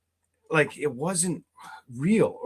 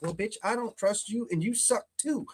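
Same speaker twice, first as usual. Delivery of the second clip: mock retort; 6 semitones higher, 6 dB quieter than usual. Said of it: "And you're like".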